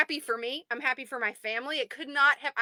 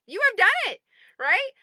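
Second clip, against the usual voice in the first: higher, louder cheer on "done"; excited repeat; 10 semitones higher, 5 dB louder than usual. Real, not acted. Repeating the praise to members, excited. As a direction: lift "done" up in triumph.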